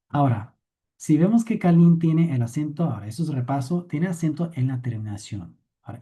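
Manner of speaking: ordinary talk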